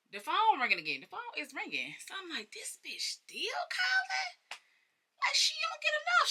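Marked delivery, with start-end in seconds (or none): 2.5-6.3 very high, squeaky incredulous voice; mock disbelief. Acts disbelief at repeated calls.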